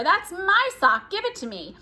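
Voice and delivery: whiny sibling demanding her sock; squabbling child. Acted. Twins quarreling over clothes.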